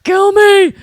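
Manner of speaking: loud high desperate cry; anguished yell. Retold or imitated. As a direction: yell it out in agony. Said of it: "every two minutes I hear".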